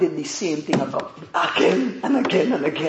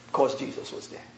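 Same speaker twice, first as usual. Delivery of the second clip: hushed echo; 8 semitones lower, 11 dB quieter, faster than usual. Acted. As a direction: drop to a near whisper for the echo. Preacher echoes phrase hushed.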